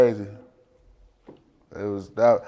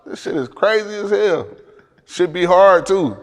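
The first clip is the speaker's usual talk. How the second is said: amazed and higher; pitched up in disbelief; surprised; 7 semitones higher than usual. Real, not acted. Reacting to copied Detroit beats.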